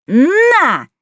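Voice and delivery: high fierce yell; battle cry. A narrator's reading. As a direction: yell it as you strike. Battle cry as she attacks.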